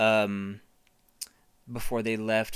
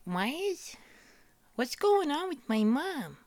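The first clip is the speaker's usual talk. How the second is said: put-on high, slow, puzzled baby; 3 dB quieter, slower than usual. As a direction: wonder aloud like a tiny creature. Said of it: "And he's like".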